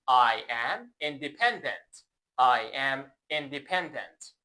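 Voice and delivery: "I am independent" drawn out slowly; slow model sentence. Shown as a demonstration. Teacher slowly models "I am independent" for repeating. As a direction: model it word by word. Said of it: "با من تکرار کنی".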